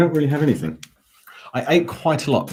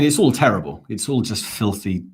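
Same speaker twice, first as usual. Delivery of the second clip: usual speaking voice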